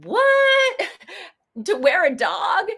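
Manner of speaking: high-pitched, loud disbelief, breaking into a laugh; re-enacted baffled double take; incredulous outburst. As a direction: squawk it in comic astonishment. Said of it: "I remember at that point being like".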